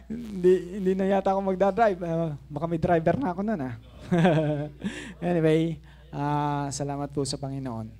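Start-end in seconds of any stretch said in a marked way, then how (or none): none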